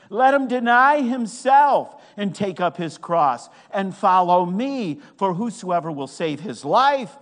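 ringing pulpit reading; preaching at full force. Real, not acted. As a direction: proclaim the verse forcefully.

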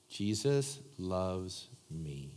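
each word drawn out slowly and softly; slow, soft recital. Shown as a demonstration. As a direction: lead the room in a slow, gentle affirmation. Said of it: "say this out loud with me".

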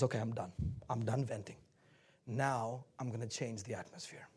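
very low, soft resolve; hushed, collected self-talk; composed after venting. Acted. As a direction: settle down and quietly decide. Acts composing oneself after venting.

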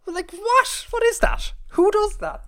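very high, loud incredulous squeal; mock outrage. Host railing at the English spelling of a city, mock outraged squeal. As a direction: shriek in comic disbelief at the nonsense.

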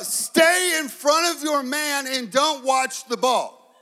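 a dad coaching from the bleachers; slow, drawn-out parent yell; sideline yell. Retold or imitated. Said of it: "when you start yelling".

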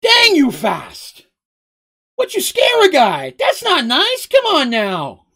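high, loud comic protest with drawn-out words; startled, scolding Mugen. A narrator's reading. Mugen startled by Seraph's speed, scolding loudly.

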